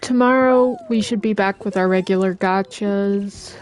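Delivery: ordinary talk, nothing marked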